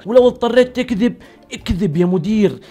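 high-pitched insistent mock coaching; hammed-up, high-voiced pep talk; worked-up urging. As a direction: push the advice hard, pitched well up. Mock coach urging shameless lying.

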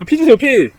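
loud high begging yell; desperate pleading. Real, not acted. Begging during fight.

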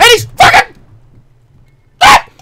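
loud high slow outrage; outraged shriek. Real, not acted. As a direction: shriek it indignantly. Being kicked by enemy.

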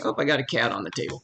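usual speaking voice

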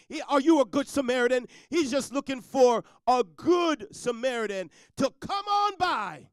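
the preacher pushes the congregation, calling out loud; preaching at full force. Real, not acted. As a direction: climb to a loud, high "on by". Preaching climax.

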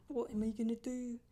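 ordinary talk

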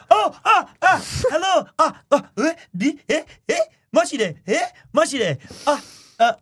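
high, loud flustered stammering greeting; flustered wife. Retold or imitated. Embarrassed wife greeting the guest, flustered and stammering. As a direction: stumble through hello, caught off guard. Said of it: "Yuki was like".

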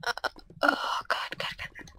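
breathless frightened whisper; panicked whisper. Real, not acted. Panicked near lava.

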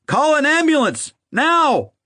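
high, frantic shout for help; loud urgent call. A narrator's reading. Father calls to his wife for an ambulance, loud and urgent. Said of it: "called out loudly to his wife".

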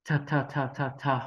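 "thờ" repeated with a breathy aspirated th; teacher drilling the aspirated "thờ"; aspirated th sound. Shown as a demonstration. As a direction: puff of air on each "thờ". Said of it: "đọc bật hơi giống như âm thờ".

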